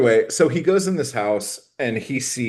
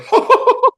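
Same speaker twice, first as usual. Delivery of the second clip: mock laugh; 8 dB louder than usual. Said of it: "And he's like".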